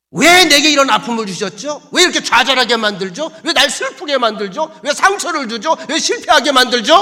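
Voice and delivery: acted high, loud wail of protest; ranting complaint. Joshua demands to know why God hurt him, ranting. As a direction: hurl each question up at God, aggrieved. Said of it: "왜 이렇게 악을 쓰고 앉았냐".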